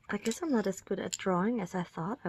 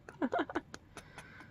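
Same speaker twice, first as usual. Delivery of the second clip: breathy high giggle; whispered giggle; 9 semitones higher, 7 dB quieter than usual. Real, not acted. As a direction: giggle under your breath. Giggles at her work.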